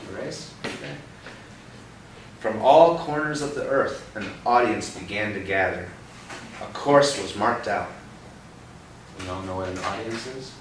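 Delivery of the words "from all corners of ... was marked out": put-on reading aloud to a child, a bit louder; bright read-aloud for a little one; storybook reading. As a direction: tell it like a picture-book story. Reading picture book to child.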